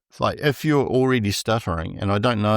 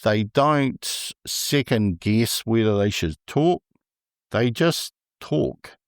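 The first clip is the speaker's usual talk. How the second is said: slow; slower than usual. Real, not acted. Stresses stutterers simply talking.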